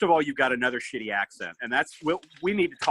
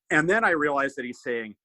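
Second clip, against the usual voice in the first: ordinary talk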